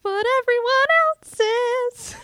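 put-on high pleading teen whine; whiny teenager. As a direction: beg like a sulky teen. Teen keeps whining.

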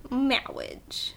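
breathy whispered "Marriage" like the cleric; whispered mock impediment. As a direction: softly mimic the film's "Marriage". Whispered movie quote.

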